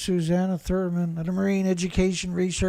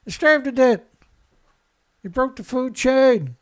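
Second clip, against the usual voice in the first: loud, blunt, hammering each word; exasperated shout; 5 semitones higher, 6 dB louder than usual. Real, not acted. Ranting at the officials' phrasing, loud exasperated shout. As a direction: yell the plain truth at them.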